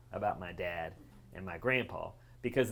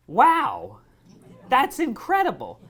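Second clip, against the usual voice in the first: loud, high awestruck exclamation; big wowed outcry; amazed reaction; 13 semitones higher, 10 dB louder, slower than usual. Retold or imitated. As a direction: burst out impressed, pitched way up. Acting out granddaughter's amazement.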